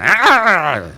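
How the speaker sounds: high, loud startled "¡Ah!"; mock startled. Mimicking a woman startled by a passerby, high and loud. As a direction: jump at it like being hassled. Mock reaction to street comment.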